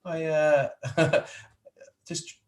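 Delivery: bashful laughing reply. Retold or imitated. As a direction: shrug it off, half laughing. His modest retold answer, laughing.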